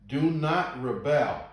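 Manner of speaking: slow, loud preacher's command; forceful scripture reading. A narrator's reading. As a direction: proclaim the warning, hitting each key word.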